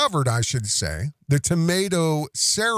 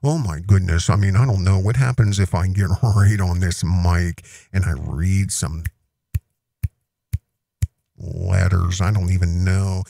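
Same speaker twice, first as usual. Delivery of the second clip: mock plain folk; 9 semitones lower, 3 dB louder than usual. Mocking Owen playing plain folk on the mic, low and close. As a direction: lower and louder, close-mic humble act. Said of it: "you gotta play plain folk".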